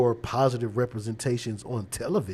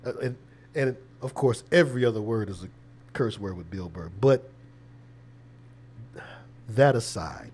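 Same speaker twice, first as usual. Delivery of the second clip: ordinary talk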